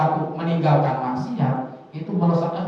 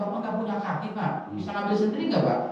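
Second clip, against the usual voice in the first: a softer, higher mock of an insolent kid; sassy child retort; 4 semitones higher, 5 dB quieter, faster than usual. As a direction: lightly play the child sneering at father. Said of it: "anak ngomongnya". (meniru anak yang menjawab kurang ajar, lebih pelan dan tinggi)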